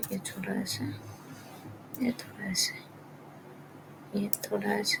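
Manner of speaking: very soft, absent-minded self-talk; muttering to self. Real, not acted. Tutor to self while fixing code, muttering softly. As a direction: murmur it to yourself while working.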